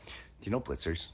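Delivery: mumbled fan question. Retold or imitated. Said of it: "They say something".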